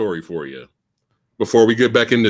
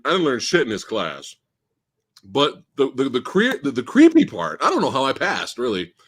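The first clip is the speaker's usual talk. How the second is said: usual speaking voice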